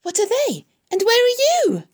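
high, loud frantic questions; screamed. A narrator's reading. Pig desperate to find the voice, screaming. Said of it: "screamed Wilbur".

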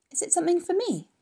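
high, eager piglet question; eager Wilbur. A narrator's reading. Wilbur asks Charlotte what she is making, eager and high. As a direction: ask it high with hopeful curiosity.

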